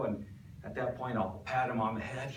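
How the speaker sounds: usual speaking voice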